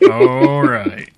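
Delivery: high laughing all right. Real, not acted. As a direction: burst out laughing. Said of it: "you're gonna be waiting a long time".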